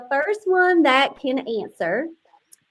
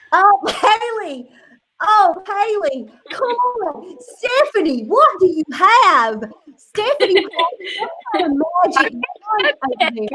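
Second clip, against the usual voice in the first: excited exclaiming; 7 semitones higher than usual. Real, not acted. Host to players showing their answers, high excited exclaiming. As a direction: exclaim it high, stretching the names.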